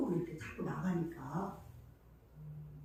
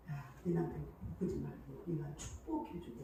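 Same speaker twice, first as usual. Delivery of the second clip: lower, softer voice of God advising; God's stern counsel; 6 semitones lower, 9 dB quieter than usual. Retold or imitated. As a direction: quietly voice God telling her to bless. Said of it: "하나님이 자꾸 나보고 그래요".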